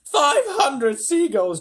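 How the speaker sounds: high, loud, slow shout. A narrator's reading. James announcing the huge catch, triumphant shout. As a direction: bellow the big tally. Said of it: "James shouted".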